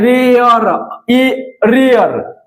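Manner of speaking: रेयर drawn out very slowly, ए gliding into अ; slow teacher's demo of रेयर; vowel glide shown. Shown as a demonstration. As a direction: stretch रेयर so both vowels ए and अ show. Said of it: "ए और अ आ रहा है रेयर में".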